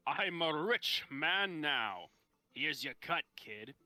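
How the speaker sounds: slow, grand lightly voiced nobleman; a hint of a pompous rich lord; rich nobleman's voice. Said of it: "in a rich nobleman's voice".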